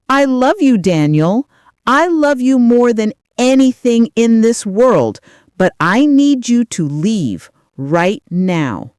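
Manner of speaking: loud, emotional declaration falling in pitch; anguished insistence. A narrator's reading. Mother sends beloved son away, anguished insistence.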